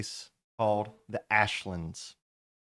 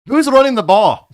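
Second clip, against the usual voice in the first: yelled high and loud; loud demand; 13 semitones higher, 18 dB louder than usual. Acted. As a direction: bark the question across the bar. Melvin yells at the player.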